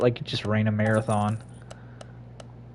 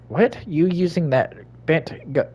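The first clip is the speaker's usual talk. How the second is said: normal talking voice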